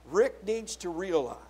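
ordinary talk, nothing marked

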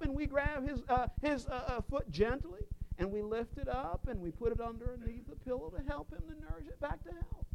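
softly narrated tender care; hushed gentle telling. Acted. Describing gently tending the foot.

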